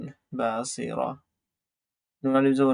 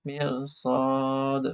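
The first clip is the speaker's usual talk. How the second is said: drawn out slow teacher reading; slow model reading; slow blended reading; much slower than usual. Shown as a demonstration. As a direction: stretch each syllable slowly for learners. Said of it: "slow joined reading of word".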